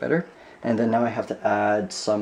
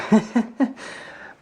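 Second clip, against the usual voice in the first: high giggle; 11 semitones higher than usual. Real, not acted. Laughing at cheap bill.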